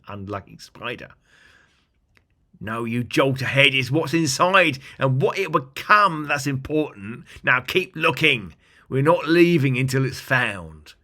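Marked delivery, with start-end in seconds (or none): 2.6-10.9 loud, scolding goblin voice; big goblin. Acted. Big goblin scolding the third goblin, loud and stern. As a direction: bark it at the foolish goblin. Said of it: "the big goblin answered back".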